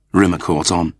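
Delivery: ordinary delivery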